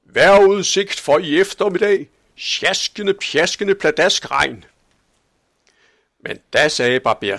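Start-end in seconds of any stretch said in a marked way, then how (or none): 0.0-4.7 loud, high shouted forecast, slowing on the last words; old man announcing rain from his tower; shouting. A narrator's reading. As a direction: call the weather out over the town. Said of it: "råbte".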